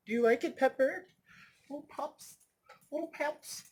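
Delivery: high, soft little coo. Real, not acted. Speaker fondly addressing Pepper, cooing.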